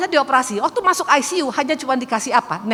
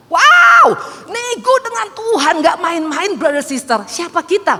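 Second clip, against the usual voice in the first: a high, loud wow, then slow, stressed words; amazed exclamation; 8 semitones higher, 6 dB louder, slower than usual. Real, not acted. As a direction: marvel out loud at bargaining with God. (takjub berseru keras dan tinggi)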